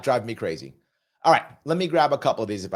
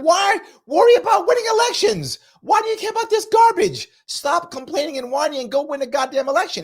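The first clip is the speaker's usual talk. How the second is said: much higher, loud exasperated shouting as Carville; imitating Carville yelling; 4 dB louder than usual. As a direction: rant at the party like an angry strategist. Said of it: "He is yelling at Democrats".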